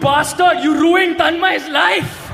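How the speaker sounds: hammed-up accusing yell; mock outrage. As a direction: rage at him like a wronged friend.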